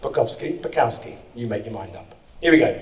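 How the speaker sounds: ordinary talk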